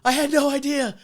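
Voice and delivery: high, loud, desperate apology; high pleading apology. Retold or imitated. Apologizing to bar regulars, high and pleading. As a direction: plead your innocence loudly. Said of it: "I was like".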